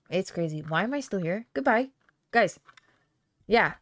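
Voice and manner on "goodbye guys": ordinary delivery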